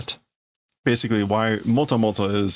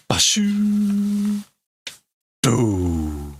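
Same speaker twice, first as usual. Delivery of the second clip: blast sound effect; 11 semitones higher, much slower than usual. Acted. Player voicing the gun blast, mouth sound effect. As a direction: mouth the beam blast, first word high.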